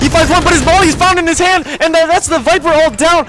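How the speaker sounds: high, loud hype play-by-play; esports caster. Real, not acted. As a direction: call every kill as it lands. Live play-by-play cast.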